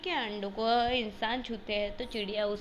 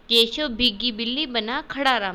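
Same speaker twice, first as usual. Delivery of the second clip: ordinary delivery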